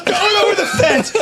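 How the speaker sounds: loud, very high mocking quote of dad; high, shouted retelling; incredulous mock yell. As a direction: crow it in amused outrage. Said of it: "he goes".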